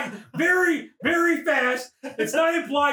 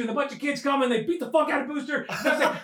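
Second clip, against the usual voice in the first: ordinary delivery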